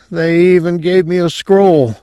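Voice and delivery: lightly voiced dazed patient, pitched high; a hint of sedation in a high voice; drugged mumble. A narrator's reading. Drugged patient recalls a gift, lightly dazed and high. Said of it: "Quayle mumbled".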